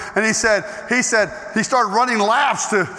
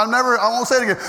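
ordinary delivery

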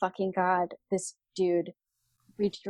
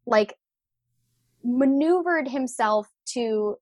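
normal talking voice

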